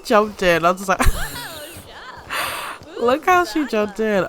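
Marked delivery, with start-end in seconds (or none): 1.0-2.9 high breathy laugh. Real, not acted. Sim jumps into dumpster.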